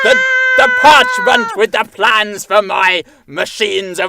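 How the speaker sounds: mock shout. Husband shouting over wife.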